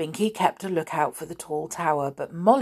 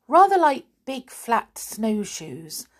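ordinary delivery, nothing marked

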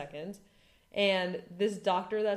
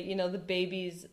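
ordinary delivery

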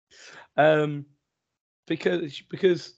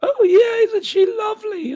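shrill, much higher, louder mock-sweet gush; fake polite parent; 10 dB louder than usual. Dad mocking his forced politeness to another parent, high and gushing. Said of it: "bloody polite to 'em and go".

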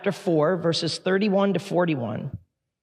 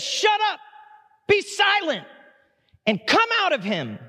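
commanding rebuke; 12 semitones higher than usual. Acted. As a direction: order the demon out with full force. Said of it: "He says".

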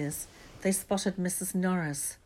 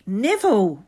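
loud, higher outburst; explosive shout; 4 semitones higher, 12 dB louder than usual. A narrator's reading. Ron exploding at Neville, loud angry shout. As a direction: explode at him. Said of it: "Ron exploded".

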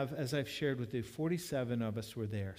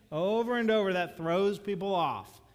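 loud, high, slowing on the second line; raised preacher voice, driving the point home; emphatic preaching; 8 semitones higher, 9 dB louder than usual. Real, not acted. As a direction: hammer home how it confuses people. Preacher stressing a common confusion.

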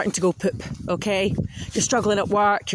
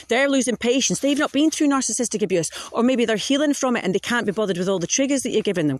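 ordinary talk

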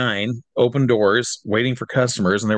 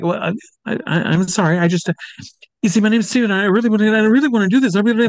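high, strained, flustered apology; flustered pleading; 10 semitones higher, faster than usual. Retold or imitated. Young fan stammering apologies to his angry idol, high and panicked. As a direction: beg frantically like a panicked kid. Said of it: "And I was like".

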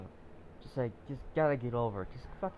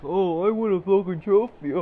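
high, loud sarcastic whine; mocking whiny kid; 8 semitones higher, 11 dB louder than usual. Vlogger mocking trophy kids, high loud sneer. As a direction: ham up a spoiled brat.

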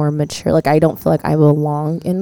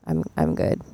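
hushed mock relief; 4 semitones lower, 9 dB quieter than usual. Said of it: "thirty-five is like".

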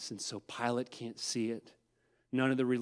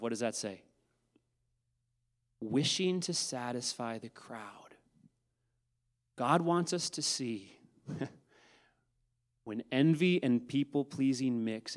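ordinary talk

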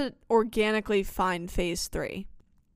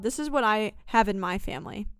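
ordinary talk

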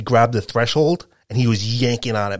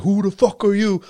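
yelling; 9 semitones higher than usual. Retold or imitated. Employee yelling at the delivery driver, angry. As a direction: bark it at the driver like a hothead. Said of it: "yelling at the driver too".

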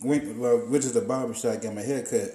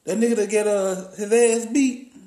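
imitating barber; 7 semitones higher than usual. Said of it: "And he was like".

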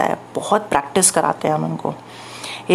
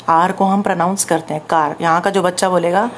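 usual speaking voice

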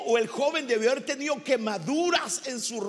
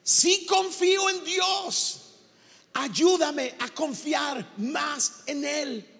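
high, slow, half-sung shout; slow, ringing shout of a desperate father begging for faith; desperate father's cry; 4 semitones higher, slower than usual. Acted. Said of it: "Gritó".

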